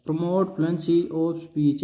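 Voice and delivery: dictating the heading at very slow pace; drawn out word by word for note-taking; teacher reading a heading slowly for notes. Shown as a demonstration.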